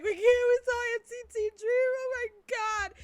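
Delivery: high-pitched wailing, imitating overwhelmed fans; mock crying. Fan retelling crying after seeing Dream, mock crying. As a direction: wail it as a tearful fangirl re-enactment. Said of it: "We're, like, crying".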